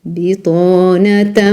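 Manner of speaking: "Bitanatan" stretched slowly, syllable by syllable; slow tajweed demo. Shown as a demonstration. Teacher modelling the word for the student, slow. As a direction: say "Bitanatan" slowly, holding the nasal. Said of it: "tu dis bitanatan".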